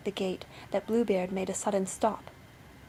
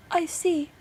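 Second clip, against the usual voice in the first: high, raised lookout voice; sister on lookout; 8 semitones higher, 3 dB louder than usual. A narrator's reading. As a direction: report down from the tower. Sister calls from the tower.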